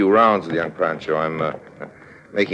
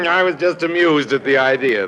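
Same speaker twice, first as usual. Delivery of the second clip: still amused; 6 semitones higher than usual. Acted. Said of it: "I shouldn't be laughing".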